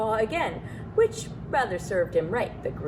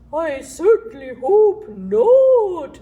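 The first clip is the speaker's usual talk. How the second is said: slow, higher and much louder hooting owl; 8 semitones higher, 10 dB louder, much slower than usual. A narrator's reading. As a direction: hoot it out like an owl. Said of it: "said the owl".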